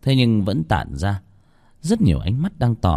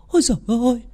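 ôi giời ơi jumps much higher, panic building; rising panic; faster than usual. Acted. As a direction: repeat ôi giời ơi far higher as fear takes over. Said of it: "Bà ấy lắp bắp". (ôi giời ơi vút cao, hoảng loạn)

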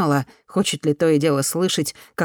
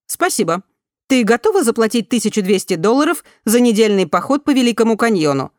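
higher, louder, polished newsreader tone; news reader voice; 5 semitones higher, 5 dB louder than usual. A narrator's reading. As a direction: read it like a news bulletin. Голос системы спрашивает. (голос диктора новостей)

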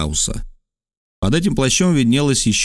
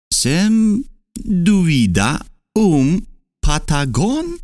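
very slow, syllable by syllable, higher; slow like a teacher; 7 semitones higher, much slower than usual. A narrator's reading. Geographer slowly questioning the native like a teacher in class. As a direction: spell it out like a patient schoolmaster. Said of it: "произнеся медленно, как учитель в классе".

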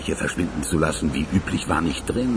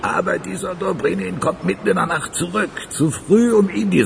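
pitched up, urgent report; agitated telling; 14 semitones higher than usual. Acted. Accomplice tells boss Dobrinin came back, voice pitched high. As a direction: rush out the bad news, voice high. Excited telling, much higher.

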